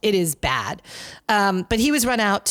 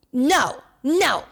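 put-on high, petulant little-kid no; childish no; 7 semitones higher than usual. Host mocking George Santos refusing reporters, childish whine. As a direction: whine it like a pouty twelve-year-old. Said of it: "like a twelve-year-old when asked".

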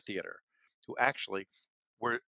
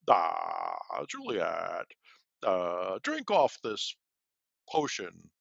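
apothecary character voice; 3 dB louder, slower than usual. Retold or imitated. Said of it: "doing the apothecary in a different voice".

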